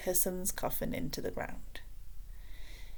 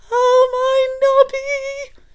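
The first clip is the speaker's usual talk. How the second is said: much higher, loud, slow far-off wail; 14 dB louder, much slower than usual. A narrator's reading. Grieving mother at the funeral, loud high slow wail. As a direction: howl your grief as you walk. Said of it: "she wailed".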